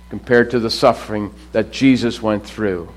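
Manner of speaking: ordinary talk, nothing marked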